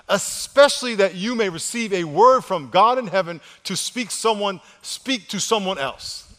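loud, slow emphatic scripture preaching; forceful preaching. Real, not acted. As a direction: drive each phrase home to the congregation.

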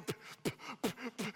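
short repeated puffing bursts; imitated puffing. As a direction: puff through popping lips. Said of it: "He's going".

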